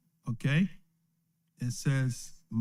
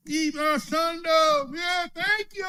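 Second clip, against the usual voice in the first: mock churchgoer thanks; slower than usual. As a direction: ham up a gushing church member. Mocking churchgoer thanking pastor.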